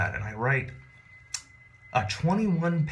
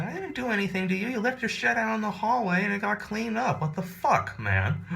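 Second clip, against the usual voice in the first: pitched way up, mock protest; high indignant retort; 11 semitones higher than usual. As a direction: squeak out your innocence. His own retort acted.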